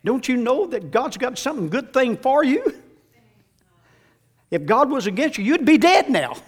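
loud, high shouted sermon climax; preacher shouting the glory to the congregation; full-force preaching. Acted. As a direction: build it into a roaring amen.

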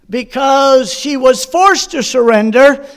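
normal talking voice

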